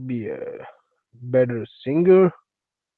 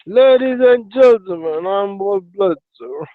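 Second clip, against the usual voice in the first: show announcer intro; 3 semitones higher than usual. Acted. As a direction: announce the live show to an audience.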